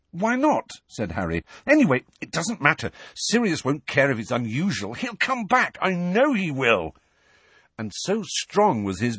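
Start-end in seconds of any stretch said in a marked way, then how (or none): 0.1-6.9 higher, stubborn hopeful voice; insistent hope. Acted. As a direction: insist he will return. Harry insisting.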